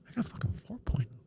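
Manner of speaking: hissed under the breath, fast and indignant; exasperated whisper. Retold or imitated. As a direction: whisper it fast like you can't believe it. Retelling anger at mom's indifference.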